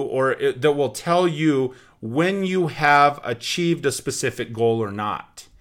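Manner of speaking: normal talking voice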